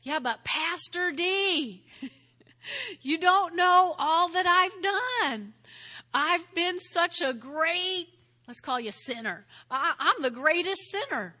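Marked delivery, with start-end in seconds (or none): 0.0-8.1 loud, slow, high protest with a laugh; mock-pleading doubter, louder and higher; objecting churchgoer. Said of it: "some people will, will say". 9.7-11.3 louder, higher insistent protest; mock doubter claiming the title; objecting churchgoer. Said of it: "some people will, will say".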